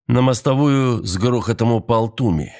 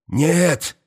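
high cry of protest; shouted no; 8 semitones higher than usual. A narrator's reading. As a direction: cry out to stop her. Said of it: "крикнул Туми".

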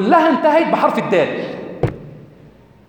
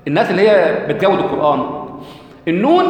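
ordinary delivery

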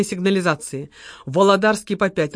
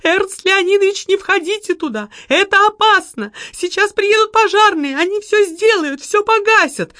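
мольба; 11 semitones higher, 3 dB louder than usual. A narrator's reading. Секретарша умоляет не входить.

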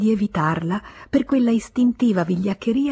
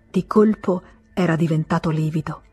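plain reading voice

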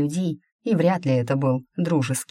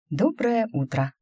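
forced bright greeting, much higher; exaggeratedly cheerful; 7 semitones higher than usual. A narrator's reading. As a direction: put on fake cheer. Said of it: "нарочито жизнерадостно сказала я".

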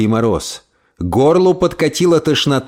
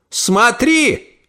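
high barked order; shouted command; 10 semitones higher than usual. Acted. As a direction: call out sharply. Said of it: "крикнула тётушка Тильди".

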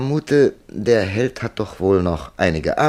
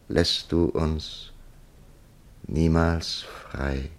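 poem recitation; 4 semitones lower than usual. Acted. Kipling reading Charlie's galley poem aloud, solemn recitation. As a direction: recite it like a dirge of galley slaves.

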